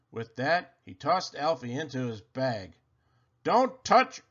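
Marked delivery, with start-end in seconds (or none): 3.4-4.2 put-on higher, louder yell after the boy. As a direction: call out a warning after him. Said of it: "Santa yelled after him".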